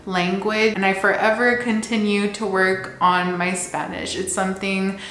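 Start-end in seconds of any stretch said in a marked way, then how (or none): none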